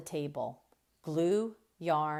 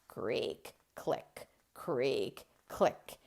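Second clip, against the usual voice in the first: creaking sound effects; 5 semitones lower, much slower than usual. A narrator's reading. Sound effects of the tow truck.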